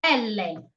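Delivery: "L" pronounced elle, two syllables; Italian L. Shown as a demonstration. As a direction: say "L" as the Italian letter name "EL-leh". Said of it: "it has to be".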